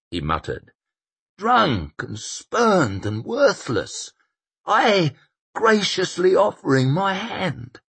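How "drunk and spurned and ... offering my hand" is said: put-on bitter old man. A narrator's reading. Koska bitter reminiscing.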